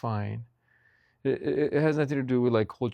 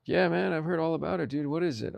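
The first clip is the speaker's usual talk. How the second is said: lightly acted, higher-pitched bro voice; curious buddy; 7 semitones higher than usual. Friend asking about the tube taco, mildly curious bro. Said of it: "your buddy's like".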